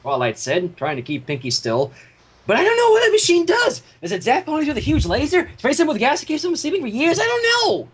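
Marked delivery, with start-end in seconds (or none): 2.5-7.9 Pinkie shouting. Acted. Pinkie shouting at Twilight about the scary machine. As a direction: panic in a squeaky rush. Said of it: "Pinkie Pie shouted".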